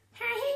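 high squeaky "Hey" replacing a swear; mock beep word. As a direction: chirp it like a censor bleep. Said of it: "it goes".

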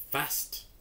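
quick and breathy; fast. Shown as a demonstration. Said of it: "how my voice goes".

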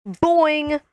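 spring the sound up like a toy; cartoon boing. Imitates bounce again.

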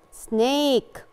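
very slow سنيك, "ay" stretched out; سنيك drawn out as "snaaayk"; long vowel in سنيك. Shown as a demonstration. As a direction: hold the "ay" in سنيك. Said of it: "الفرق بين الشورت فاول واللونج فاول".

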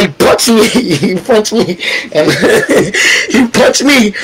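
telling it through real laughter; laughing. Real, not acted. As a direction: crack up at your own misfortune. Laughs while recalling being punched.

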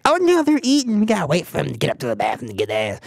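high put-on voice for silly staff; hammed-up mimicry of restaurant rules; mocking mimicry. Mocking restaurant staff, in a high put-on voice.

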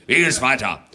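loud, scolding Father Christmas; a strict Santa telling off the parents; stern Santa. Acted.

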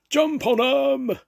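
very high, loud, slow pirate battle roar. Acted. Jake ordering his men to attack, a loud high roar. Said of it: "roared Cutthroat Jake".

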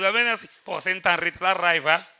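normal talking voice

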